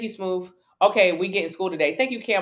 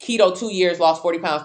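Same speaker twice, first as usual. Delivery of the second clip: normal talking voice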